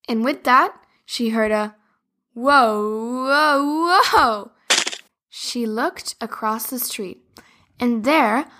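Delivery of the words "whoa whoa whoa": rising alarmed whoa; falling cry. Acted. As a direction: cry out while toppling. Toy falling off ladder.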